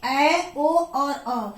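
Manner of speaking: ऐ, ओ and अ drawn out slowly; slow classroom recital of ऐ, ओ, अ; long vowels recited. Shown as a demonstration. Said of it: "ये आते हैं दीर्घ स्वर में".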